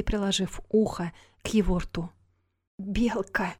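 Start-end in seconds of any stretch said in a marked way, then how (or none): 2.8-3.6 barely audible dying whisper; faint whisper. Acted. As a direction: breathe the name out with no voice. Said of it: "едва слышно шепнул он". (едва слышный шёпот)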